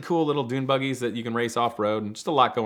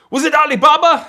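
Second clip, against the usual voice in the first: much higher and louder, a mock accented motel clerk; imitating the clerk; 14 dB louder than usual. Motel clerk guessing who took the call, loud mock accent. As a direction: blurt the name out loud and high.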